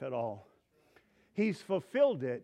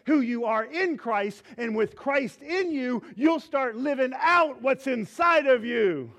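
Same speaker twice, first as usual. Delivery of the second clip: loud, driving preacher's build; rising sermon fervor; preaching at full force; 4 semitones higher, 6 dB louder than usual. Real, not acted. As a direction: preach it out to the whole church, louder.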